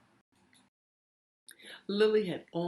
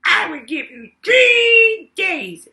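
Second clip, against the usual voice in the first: much higher, much louder, slow shouted goblin; 12 semitones higher, 14 dB louder, slower than usual. Acted. Goblin taunts Lily with a deal, high slow shout. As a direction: bellow it like a gleeful goblin. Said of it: "said the goblin".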